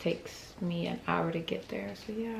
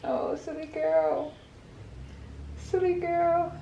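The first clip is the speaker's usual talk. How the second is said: higher, slow cooing; 9 semitones higher than usual. Real, not acted. Cooing at baby.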